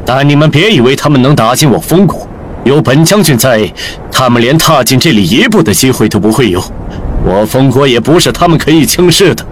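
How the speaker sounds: deep, arrogant general addressing a crowd; low-pitched scorn, proudly declaimed; contemptuous, haughty proclamation. A narrator's reading. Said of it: "用着鄙夷高傲的口吻语气高声喊道".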